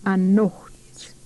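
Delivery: teacher whispering Anocht back to the class; whispered. Shown as a demonstration. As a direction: breathe Anocht like a secret. Teacher repeats word in whisper.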